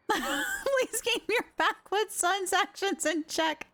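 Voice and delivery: high, giggling read of the line; reading aloud through giggles; laughing while reading. Real, not acted. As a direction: try to read it but keep cracking up. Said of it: "my cheeks hurt so much from laughing".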